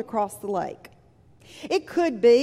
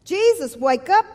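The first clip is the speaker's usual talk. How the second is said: louder, higher cry to wake Jesus; urgent call; 6 semitones higher, 6 dB louder than usual. Retold or imitated. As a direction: shout him awake in mock panic. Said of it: "they said".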